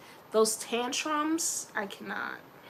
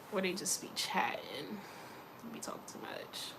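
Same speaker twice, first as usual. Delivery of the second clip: whisper; 4 semitones lower, 10 dB quieter than usual. Real, not acted. Complaining about chatty kids.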